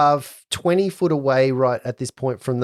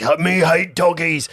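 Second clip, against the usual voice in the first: dopey cartoon bellow; 5 semitones higher than usual. Giant voiced again.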